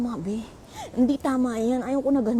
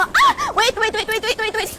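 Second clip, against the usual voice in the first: high, loud rapid waits breaking into a laugh; panic-laugh as you run for the door; frantic calling; 10 semitones higher, 5 dB louder than usual. Real, not acted. Chasing bus, frantic repeats. (natatarantang tawag na may tawa)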